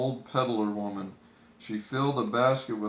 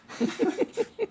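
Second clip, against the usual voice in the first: giggle. Acted. High giggle in character.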